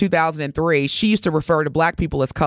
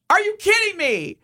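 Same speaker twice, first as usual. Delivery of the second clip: incredulous; 12 semitones higher than usual. Real, not acted. Repeats outrage.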